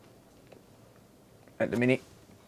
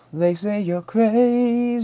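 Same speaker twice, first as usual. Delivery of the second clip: mock singsong; 10 semitones higher, much slower than usual. Mock singsong reply to chat.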